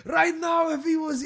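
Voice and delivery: high, loud, blustering wrestler boast; Iron Sheik impression. Backstage, the Iron Sheik bragging to young comics, high loud shouting. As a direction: shout it like a trash-talking old wrestler. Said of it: "my Iron Sheik was going".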